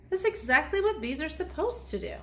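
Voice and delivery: plain reading voice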